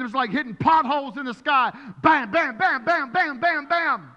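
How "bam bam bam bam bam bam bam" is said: turbulence bams. Retold or imitated. Imitating plane turbulence hits.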